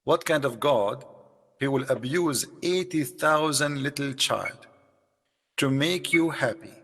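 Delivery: ordinary talk, nothing marked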